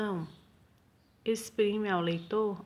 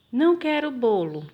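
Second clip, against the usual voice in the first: "Não quero bolo" em voz bem mais alta, "Não" enfático; recusa firme; 3 semitones higher, 8 dB louder than usual. Shown as a demonstration. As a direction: negar o bolo com força. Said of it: "Ela tá falando que não quer o bolo". (recusa alta e enfática)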